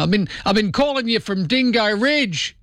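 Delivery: broad Australian caricature, pitched high; exaggerated Australian radio caller; hammed-up Aussie caller. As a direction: lay on the Aussie slang and twang thickly. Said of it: "make up a name".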